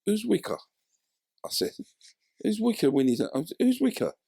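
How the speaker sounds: confused. Retold or imitated. Confused about Wikipedia.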